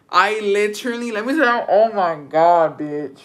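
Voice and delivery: ordinary talk, nothing marked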